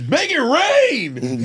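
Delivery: high, slow, drawn-out quote; slow movie quote. Retold or imitated. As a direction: stretch it out like the actor. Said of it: "when he goes".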